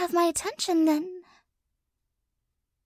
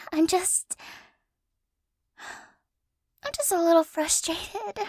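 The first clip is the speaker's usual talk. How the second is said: ordinary delivery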